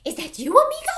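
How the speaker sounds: very high, loud astonished question; hammed-up delighted surprise; exclaiming. A narrator's reading. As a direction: squeak it out in amazed recognition. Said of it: "exclaimed Scapito".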